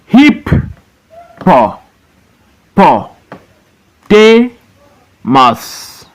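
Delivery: each syllable of "hip, po, po, te, mas" alone with a long pause; high "hip" and "te", falling "mas", slowly sounded out; broken into syllables. Shown as a demonstration. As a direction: teach "hip, po, po, te, mas" one isolated beat at a time. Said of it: "You break into syllables".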